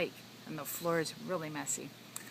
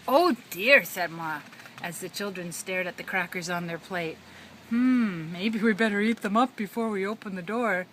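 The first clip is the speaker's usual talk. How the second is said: mock worried mom; 7 dB louder than usual. Ma voiced worried.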